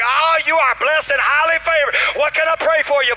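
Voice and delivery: high, loud preacher gushing; hammed-up pastoral shout; effusive blessing. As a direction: bless someone at full preacher volume. Pastor blesses agent in retold scene.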